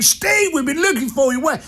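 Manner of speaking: high, excited hail from a pumped-up client. Retold or imitated. Client finds Steve hiding below deck, pumped up and high. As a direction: call out like you've been hunting for him all night. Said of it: "Now, he's all pumped up".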